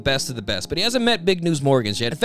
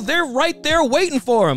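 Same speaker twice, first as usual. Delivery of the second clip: ordinary talk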